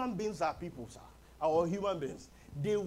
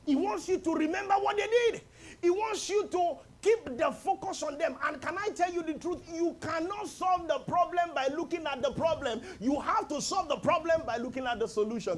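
raised, ringing preacher's voice; preaching at full force; 8 semitones higher, 4 dB louder than usual. Acted. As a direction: drive the point home to the congregation.

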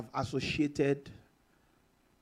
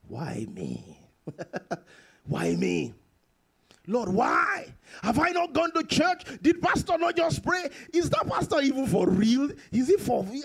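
mock frustrated believer; 6 semitones higher than usual. Acts a complaining believer.

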